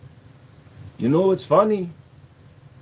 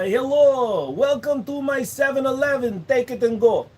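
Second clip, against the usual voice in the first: raised Indian accent for a convenience-store clerk; a higher Indian accent, brisk clerk; Indian shopkeeper accent; 8 semitones higher than usual. Shown as a demonstration. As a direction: greet a customer at the seven eleven. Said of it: "Can you do a Russian accent? ... You grew up in India".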